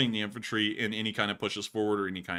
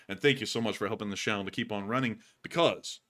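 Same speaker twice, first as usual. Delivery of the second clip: ordinary talk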